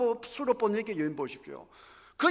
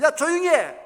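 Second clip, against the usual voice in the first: loud, higher sharp rebuke; crowd scolding; 7 semitones higher, 8 dB louder than usual. Retold or imitated. Crowd scolding Bartimaeus to shut up, loud rebuke. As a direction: bark at the blind beggar to hush.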